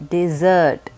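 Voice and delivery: "dessert" with the weight on -SERT; second syllable stressed. Shown as a demonstration. Teacher repeats for learners, "dessert" stressed on -SERT. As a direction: say "dessert" and hit the last syllable. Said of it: "The emphasis is on the second syllable".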